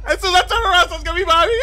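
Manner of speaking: anxious. Real, not acted. Gamer dreading what's behind him, anxious and loud. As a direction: brace yourself for the jump scare. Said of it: "you don't understand my anxiety".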